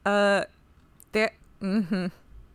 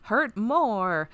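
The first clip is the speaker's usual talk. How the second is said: hammed-up wounded husband, louder and higher; mock melodrama; 5 semitones higher, 6 dB louder, slower than usual. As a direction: overplay the grief, stretching the key words. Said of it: "It's very melodramatic".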